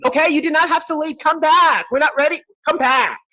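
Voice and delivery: high-pitched mock pleading. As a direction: call them back in a squeaky plea. Caller left the live.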